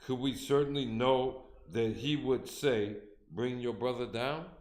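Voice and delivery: ordinary delivery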